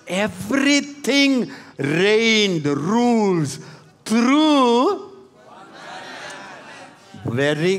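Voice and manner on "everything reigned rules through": preaching at full force. Real, not acted. Climactic preaching shout.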